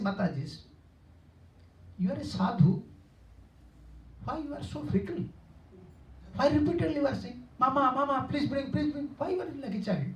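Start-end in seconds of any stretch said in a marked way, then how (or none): 2.0-10.1 high-pitched mock scolding. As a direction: tell off a fickle sadhu like a mother. Village mothers scolding the sadhu.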